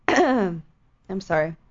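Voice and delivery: choking mid-sentence; throat catching, strained; choking on drink. Real, not acted.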